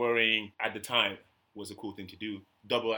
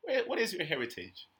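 higher, sweet, over-polite question; exaggerated polite asking; 8 semitones higher, 3 dB quieter than usual. Showing strangers how to ask politely, in a higher over-courteous voice. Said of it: "Ask me, like".

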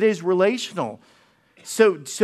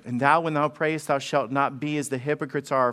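lower, measured reading of the verse; scripture reading; 4 semitones lower than usual. Acted. Pastor reading verses to the congregation, measured scripture reading. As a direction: read the scripture soberly.

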